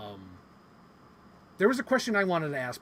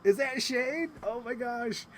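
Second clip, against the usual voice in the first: high, rising retold shock; scandalized disbelief; 9 semitones higher, slower than usual. Retelling the moment the actress threw shade, scandalized. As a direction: question it like juicy gossip just landed. Recounting own scandalized reaction.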